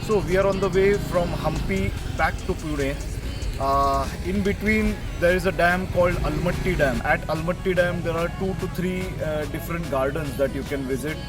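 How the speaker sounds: muffled voice inside a riding helmet; talking through a closed helmet. Real, not acted. Recorded on the bike ride.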